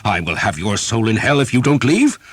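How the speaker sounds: very high, loud outraged threat; furious priest. A narrator's reading. Priest turning the knight's threat back, furious. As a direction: throw his warning back at him. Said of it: "Father Hobb had a temper".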